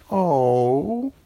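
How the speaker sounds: loud, drawn-out sad oh; a crowd of oranges let down; disappointed chorus. A narrator's reading. Oranges disappointed at the girl's refusal, loud.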